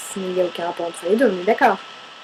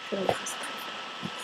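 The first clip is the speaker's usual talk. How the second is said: tender whisper; 14 dB quieter, slower than usual. Real, not acted. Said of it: "tender message to her ex".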